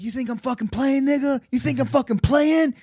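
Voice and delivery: high-pitched mock aggressive challenge; imitated angry attacker.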